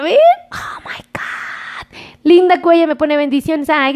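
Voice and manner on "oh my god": breathless stunned whisper; a soft astonished whisper to the viewers. Real, not acted. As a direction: hush it out, amazed. Shocked at winners' speed.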